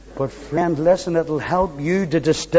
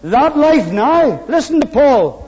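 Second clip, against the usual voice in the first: full-force preaching; 9 semitones higher, 7 dB louder than usual. Acted. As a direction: preach it out with ringing conviction.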